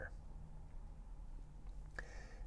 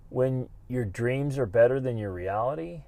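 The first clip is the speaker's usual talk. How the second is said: ordinary talk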